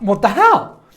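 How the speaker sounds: stunned seller at the door reacting to being told off; shocked. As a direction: blurt it out in disbelief. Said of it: "I was so shocked".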